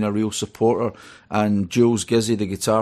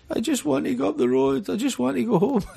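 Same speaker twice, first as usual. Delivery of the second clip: much higher, whimpering mock-pathetic self; mock pitiful plea. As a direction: play your own defeated younger self, squeaking to go home. Retold plea to leave the gig.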